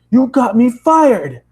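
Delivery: high, loud, slow accusing yell; shouting at the girl who cost him his job; angry accusation. Acted. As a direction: hurl the blame at her. Narrator yells accusation at girl.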